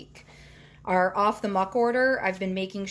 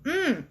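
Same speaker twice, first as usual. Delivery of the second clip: pleased tasting hum; savoring hum with mouth full; mmm of delight; 7 semitones higher than usual. Real, not acted. Tastes the noodles.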